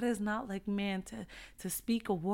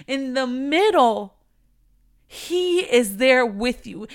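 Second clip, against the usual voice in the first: louder, higher preaching that swells to a peak; rising sermon fervour; building preaching; 4 semitones higher, 9 dB louder than usual. Acted. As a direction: preach it up until the middle lines ring out. Preaches building to full force.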